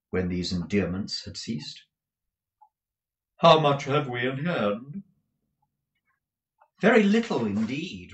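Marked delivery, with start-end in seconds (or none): 3.4-5.0 high, loud, gushing dandy husband; a flamboyant husband fussing over money; Mantalini's voice. Acted. Mantalini asks his wife about the cash, high and gushing. 6.8-8.1 higher and louder, a lightly voiced wife; a wife admitting money is short; Madame's voice. Madame tells her husband little is left, lightly voiced.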